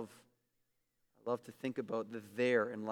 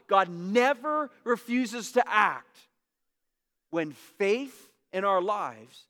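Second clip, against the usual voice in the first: slow, louder, higher with stress on to; deliberate preaching emphasis; slow emphatic repeat; 6 semitones higher, 5 dB louder, slower than usual. Shown as a demonstration. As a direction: drive the line home slowly. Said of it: "repeating the quote".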